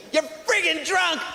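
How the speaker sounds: high shrill nagging wife. Acted.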